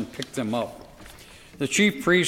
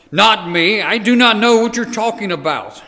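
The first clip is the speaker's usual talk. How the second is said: higher, louder panicked denial; raised, alarmed voice; 8 semitones higher, 8 dB louder than usual. Acted. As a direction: push the accusation away loudly. Peter denying before everyone.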